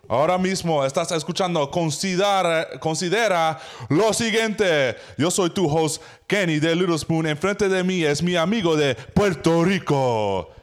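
loud, high Spanish show host. Acted. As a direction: welcome the audience like a TV announcer. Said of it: "Take two".

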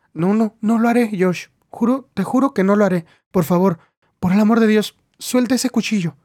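frightened pleading. Acted. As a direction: beg him to drop the knife.

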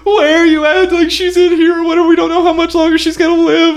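high, loud, frantic tearful pleading; fake crying girlfriend. Scene: girlfriend fake-cries on the phone to fool the cop, high frantic wail. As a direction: ham up a fake sob on the phone. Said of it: "she called and she started crying".

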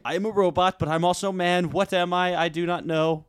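high, evenly stepped mock robot; RoboCop. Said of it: "what does Robo".

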